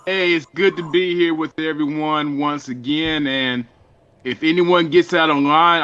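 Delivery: normal talking voice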